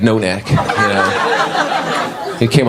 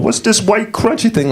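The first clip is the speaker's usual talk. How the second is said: LaWanda puzzled over an egg roll; 10 semitones higher than usual. Retold or imitated. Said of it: "I'm like".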